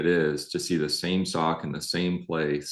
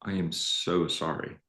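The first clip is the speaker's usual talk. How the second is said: tender apology; 7 dB quieter than usual. Retold or imitated. Husband retells his soft, tender apology to his crying wife. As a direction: murmur a heartfelt sorry. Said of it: "I said".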